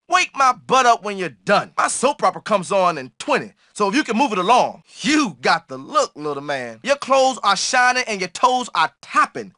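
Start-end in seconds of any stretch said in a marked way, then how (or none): none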